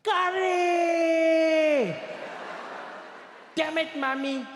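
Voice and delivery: high, drawn-out scream of dismay; loud, long outraged wail; top-of-the-lungs scream. Retold or imitated. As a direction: scream the first word long and high, then curse. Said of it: "he'd scream at the top of his lungs".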